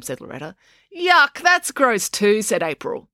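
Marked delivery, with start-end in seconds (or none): 1.0-2.4 put-on disgusted girl. April disgusted by dad's romance.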